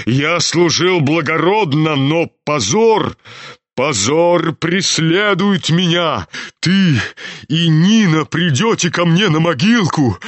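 slow, declaimed self-pity; anguished old general. A narrator's reading. General laments his shame to his son, anguished. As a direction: lament your disgrace in drawn-out phrases.

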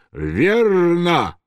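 high, long drawn-out cry; shouted approval. A narrator's reading. Myshlaevsky cries out to the actor, exultant shout. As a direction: call it out from the stalls, stretched. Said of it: "я не выдержал и крикнул".

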